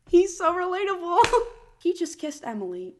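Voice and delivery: high, loud, laughing through it. Real, not acted. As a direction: crack up while you say it. Laughing at the plot.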